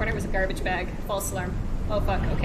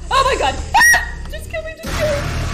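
loud high panicked scream; terrified scream; 7 dB louder than usual. Real, not acted. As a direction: scream for your life. Monster attacks.

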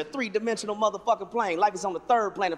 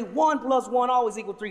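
ordinary talk, nothing marked

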